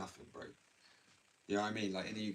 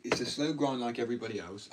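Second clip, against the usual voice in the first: ordinary talk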